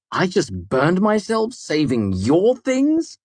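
slow, high feigned dismay. A narrator's reading. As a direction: sound wounded and put out. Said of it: "proper amount of dismay in my tone".

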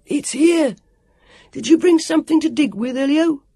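put-on high, loud excited kid shout; bawling. A narrator's reading. Sam asking Elio for digging tools, bawling. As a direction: yell over to the others as a boy. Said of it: "Sam bawled".

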